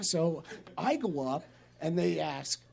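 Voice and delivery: ordinary talk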